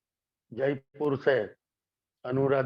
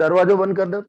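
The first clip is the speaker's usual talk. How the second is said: calling out; 5 semitones higher, 7 dB louder than usual. Real, not acted. Calls out to shut door.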